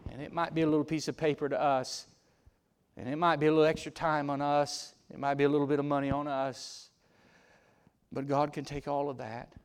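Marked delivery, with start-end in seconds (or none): none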